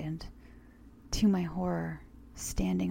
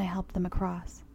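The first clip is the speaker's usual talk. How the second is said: ordinary delivery, nothing marked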